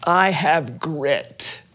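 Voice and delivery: very slow, low question. Acted.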